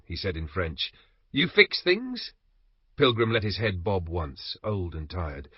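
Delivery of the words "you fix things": high slow mocking question.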